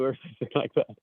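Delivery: usual speaking voice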